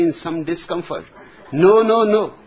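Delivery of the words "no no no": higher, louder stammering no's; flustered shout. Acted. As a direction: blurt them out exasperated. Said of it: "stuttered the old colonel".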